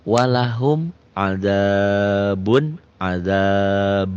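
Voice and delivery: slow, melodic tajwid chanting; chanted Quranic reading; Quran recitation. Shown as a demonstration. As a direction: recite it slowly with drawn-out vowels. Recites example slowly.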